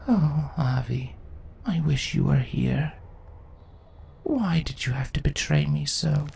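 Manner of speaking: hurt, hushed sorrow; wistful whisper. Acted. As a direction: whisper to someone gone. Queen longing for betrayer.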